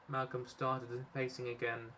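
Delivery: ordinary delivery, nothing marked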